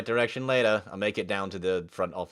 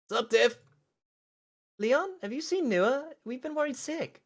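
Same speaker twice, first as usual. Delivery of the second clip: put-on much higher, bright teen girl; high girly voice. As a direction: play the worried schoolgirl. Voicing Tiffany, high voice.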